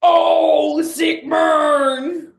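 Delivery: loud, gravel-free high shout in a put-on jazzman voice. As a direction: belt it out like a showman hamming it up. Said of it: "[name] in the background goes".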